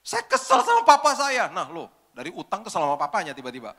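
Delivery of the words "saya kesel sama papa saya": high, loud and slow, tearful; imitating a sobbing young woman; crying complaint. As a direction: wail it out through tears. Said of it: "Tiba-tiba dia nangis".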